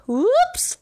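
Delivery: high squealed whoops; surprised whoops. A narrator's reading. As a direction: yelp it as he tumbles. Mouse flipping off sled.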